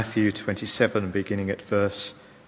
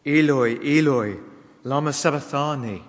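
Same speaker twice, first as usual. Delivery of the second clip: raised, higher voice of a read-out cry; measured loud outcry in a reading; crying out loudly; 4 semitones higher, 5 dB louder than usual. A narrator's reading. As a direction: call it out loud, as a scripture reader would. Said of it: "cried out in a loud voice".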